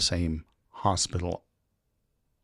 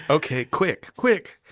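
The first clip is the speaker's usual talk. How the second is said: higher, hurried inner voice; mock panic; 7 semitones higher, 4 dB louder, slower than usual. Traveler stalling the officer, hammed-up panicky inner voice. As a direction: think fast in a fake panic. Scrambling for an answer.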